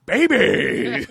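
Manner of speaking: high, loud silly yell; silly shout. Pretend. Joking riff.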